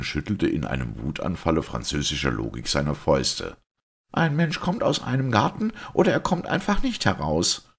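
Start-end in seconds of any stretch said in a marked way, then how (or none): 4.1-7.7 high-pitched, exasperated outcry; angry cry. A narrator's reading. Simon argues with the priest about the garden, crying out angrily. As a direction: cry out in a fit of logical outrage, pitch way up. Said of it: "schrie er".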